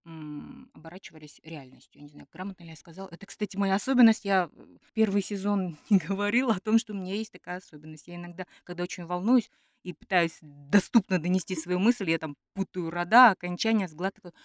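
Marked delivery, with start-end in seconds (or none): none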